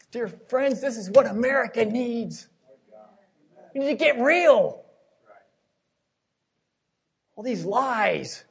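loud, rising hellfire sermon voice, stretching the big question slowly; fiery preaching. Acted. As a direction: thunder it from the pulpit. Preaching at full force.

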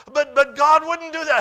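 very loud, high mock wail. Upset woman arguing in front of everyone, loud imitated wail. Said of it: "she's getting louder, and she's crying".

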